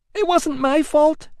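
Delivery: put-on high, plaintive young woman's wail; whiny Amelia. A narrator's reading. Amelia wailing to her cousin, high and plaintive. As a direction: wail it like a spoilt cousin begging pity.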